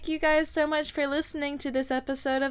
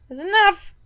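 louder, higher repeat to shut it down; firm cut-off; 6 semitones higher, 6 dB louder than usual. Real, not acted. Host cutting off co-host's sales bit, firmly. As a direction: put your foot down, louder.

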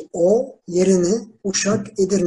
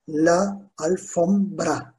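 "La alfombra" slowly, piece by piece; syllable by syllable; much slower than usual. Shown as a demonstration. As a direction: separate each syllable of "La alfombra" for learners. Teacher breaks the word into syllables. ("La alfombra" sözünü yavaşça hecele)

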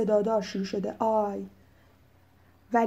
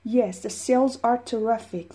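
slow model reply; much slower than usual. Shown as a demonstration. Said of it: "repeating dialogue".

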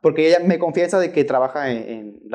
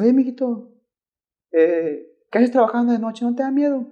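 higher voiced granny, kindly; sweet old lady; 8 semitones higher than usual. Old lady passenger asking the driver, higher granny voice. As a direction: mijito said up high like a grandma.

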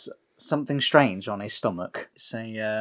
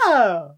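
higher, louder mock "ah"; deflated monster wail; 8 semitones higher, 6 dB louder than usual. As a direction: let out a thwarted little cry. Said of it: "And he's like".

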